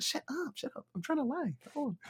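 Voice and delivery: hushed aside. Retold or imitated. Talker hushing his gang away from the mic, high hushed shush. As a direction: shush the gang beside you.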